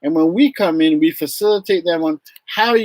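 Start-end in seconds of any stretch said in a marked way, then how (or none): none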